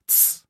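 soft, unvoiced "tsss"; quiet warning shush; whispered shush. A narrator's reading. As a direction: hush someone secretively. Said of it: "прошептала она".

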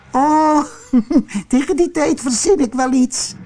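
very high, giggly aunt voice; Tante Eefje giggling. A narrator's reading. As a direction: giggle coyly, then breeze on quickly. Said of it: "giechelde tante Eefje". (giechelend, met hoog stemmetje)

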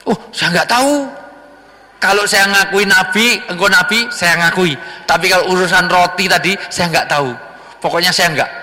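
high, dodging denial; evasive liar. Acted. Young man denies eating bread.

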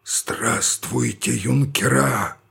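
put-on a voiceless old general straining his throat; hissing graveyard voice. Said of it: "за могильным, шипящим голосом".

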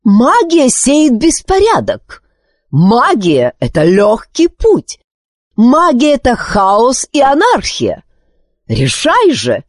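high-pitched furious tirade; queen crying out in temper; angry shouting. A narrator's reading. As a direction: rail against it, hitting each charge. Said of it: "крикнула она".

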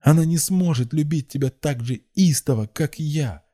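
very high, fervent claim; frenzied outburst. A narrator's reading. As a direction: blurt it out in a passion. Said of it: "выпалила в выступлении девушка".

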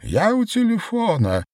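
very high-pitched man on phone; high vibrating voice. A narrator's reading. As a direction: answer in a quavering high pitch. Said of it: "удивительным высоким вибрирующим голосом".

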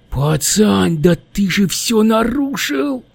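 high, slow, exasperated little demon; outraged gremlin. A narrator's reading. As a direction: throw up your hands as the gremlin. Gremlin exclaims. (возмущённый гремлин)

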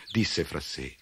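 ordinary delivery

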